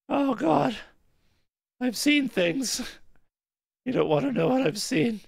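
high, shaken mock-haunted voice; haunted survivor voice. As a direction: play a rattled witness who's seen too much. Hammy haunted character line.

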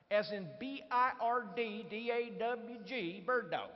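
slow Southern spelling-out; Southern accent. Retold or imitated. Boss checking a visitor's odd name, in a Southern accent. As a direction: spell it letter by letter in a Southern drawl. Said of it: "a beautiful Southern accent".

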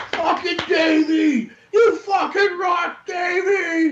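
put-on drunk yell, high and slow; drawn-out drunken shout at the stage; drunken fan yell. Drunk fan yelling at concert.

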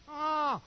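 high, sharp grieved "Ah!"; a startled cry of hurt; pained gasp. Pretend. Holy Spirit voiced as grieved.

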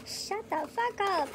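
high, drawn-out disbelief; stunned disbelief. Real, not acted. Vlogger finding four palettes in the box, in stunned disbelief.

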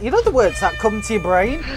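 usual speaking voice

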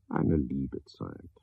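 softly drawn-out reverie; soft musing. Acted. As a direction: savor the words, drifting off. Musing alone at night.

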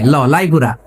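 "লাইপুরা" with L in place of R; mock Raipura accent, L opening "লাইপুরা"; R becomes L. Shown as a demonstration. Preacher mimics Raipura locals for the crowd, saying "লাইপুরা" with L for R. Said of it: "রায়পুরা বলতে পারে না".

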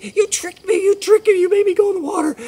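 high, loud outraged squeal; indignant mouse. Acted. Mouse accusing the frog of trickery, indignant outburst. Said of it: "And, and she said".

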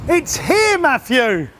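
loud, high call across the yard. Real, not acted. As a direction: shout a name to someone far off. Calling out to Matthew.

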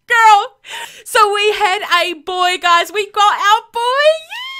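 loud, high, building to a shouted yay; real delight spilling over; ecstatic. Real, not acted. Telling viewers they got their boy, shouting with joy.

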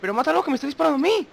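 high, urgent, fast plea; urgent pleading. Real, not acted. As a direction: press your partner desperately. Begging partner to kill archer.

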